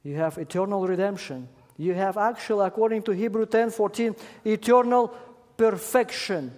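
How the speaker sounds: loud, high preaching build; full-voiced pulpit preaching; preaching at full force. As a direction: proclaim it to the congregation with fire. Preacher rising to emphasis.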